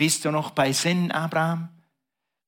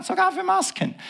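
mock surprise. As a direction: voice jumps way up on masks. Preacher mocks thanks for masks.

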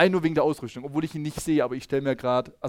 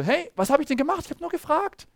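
much higher, wide-eyed protest; innocent David; 12 semitones higher than usual. Acted. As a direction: play the hurt, innocent boy. David defends himself innocently. (hoher, unschuldiger Protest)

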